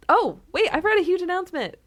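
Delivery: high, loud burst of remembering; sudden excitement. Real, not acted. Host interrupts her own sign-off, suddenly excited. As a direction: break off excitedly as it hits you.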